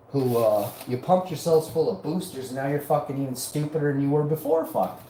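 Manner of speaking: ordinary talk, nothing marked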